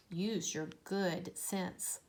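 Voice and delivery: slow, soft motherly counsel; slow gentle advice. Acted. Mother gently advising her little bat, slow and soft. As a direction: lay each word down slowly and kindly.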